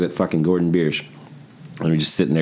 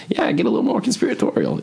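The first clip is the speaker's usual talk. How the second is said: much higher sing-song teasing voice; mock reply to friend. Teasing friend Asher by text, high goofy mock voice. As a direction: egg him on in a goofy high voice. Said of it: "And I was like".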